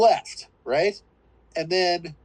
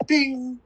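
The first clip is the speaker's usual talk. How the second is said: mock piano note; 7 semitones higher than usual.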